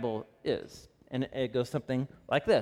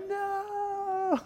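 a sudden, much higher crying no; child's wailing no; 6 dB quieter than usual. Retold or imitated. A dad voicing his son who sees the tweezers, high crying wail. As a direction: wail it like a toddler dreading the tweezers. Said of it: "he's like".

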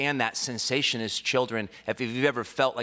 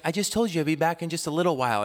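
fast, higher-pitched retort; rushed mock exasperation; exasperated mother; 8 semitones higher, faster than usual. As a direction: imitate a mom impatiently repeating herself. Exasperated mother returns, retold.

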